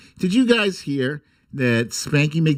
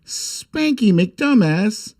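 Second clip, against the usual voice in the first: announcer; slower than usual. Acted. Announcing the next page.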